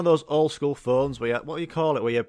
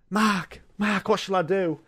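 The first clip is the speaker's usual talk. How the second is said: mock pleading contestant; 7 semitones higher, 3 dB louder than usual. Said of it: "Linda's like".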